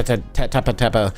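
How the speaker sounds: ordinary talk, nothing marked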